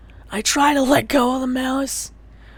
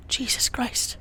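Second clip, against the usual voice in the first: normal talking voice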